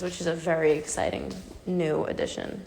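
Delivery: ordinary talk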